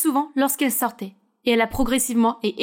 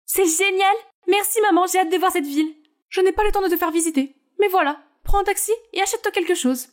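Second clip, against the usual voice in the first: higher, bright character voices; excited girl and busy mom; 7 semitones higher than usual. Acted. Naomi thanks her mother, higher excited voice.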